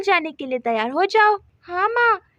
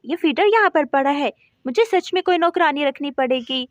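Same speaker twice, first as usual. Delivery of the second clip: usual speaking voice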